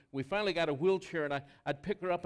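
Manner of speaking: usual speaking voice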